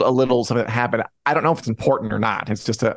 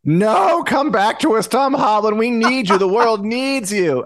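mock plea; 10 semitones higher, slower than usual. Pleading to Tom Holland.

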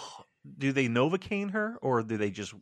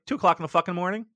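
usual speaking voice

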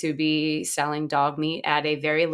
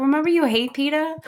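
high, teasing comeback; high mocking retort; 9 semitones higher, 4 dB louder than usual. Answering hypocritical callers, high teasing retort. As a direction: tease them with a raised voice. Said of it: "I'm like".

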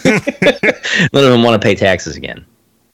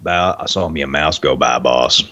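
imitating a very deep movie voice; deep-voiced movie character; 9 semitones lower than usual. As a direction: drop way down like a giant of a man. Deep voice impression after remark.

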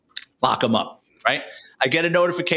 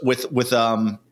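ordinary talk

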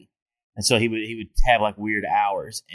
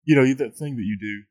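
put-on light British accent, teasing the host. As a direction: rib him in a mild British voice. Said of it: "I'll become British for you right now".

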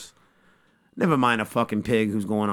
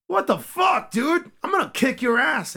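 put-on shrill kid Goku. Goku yelling at Yamcha who blew up the home, in a high kid voice. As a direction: squeak it out like an angry little boy.

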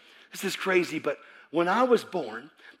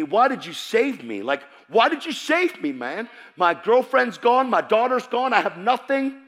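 raised, pleading complaint; anguished protest; 6 semitones higher, 5 dB louder than usual. Retold or imitated. Man who lost everything questioning God, anguished protest. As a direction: protest loudly, higher as the losses pile up.

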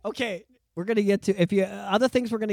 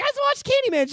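put-on scared kid voice; 4 dB louder than usual. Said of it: "I'm like".

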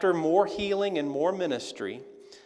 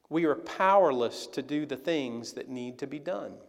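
ordinary talk, nothing marked

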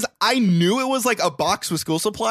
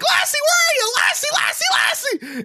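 put-on boy searching the house for his dog; calling out. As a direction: shout the name through the house. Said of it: "He's like".

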